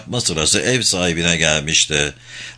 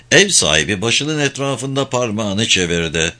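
ordinary delivery

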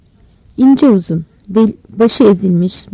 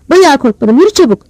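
high, raised-voice rebuke; impatient scolding; 6 semitones higher, 5 dB louder than usual. A narrator's reading. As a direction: cut the boy off and hurry him along. Grandmother snaps at grandson.

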